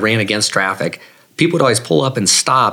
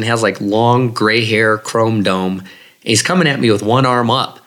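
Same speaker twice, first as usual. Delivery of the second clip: usual speaking voice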